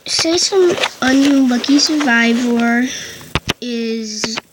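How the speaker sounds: normal talking voice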